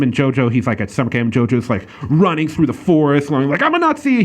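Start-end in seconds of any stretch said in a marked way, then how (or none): 3.6-4.2 put-on eager kid shout. Retelling kid running shouting.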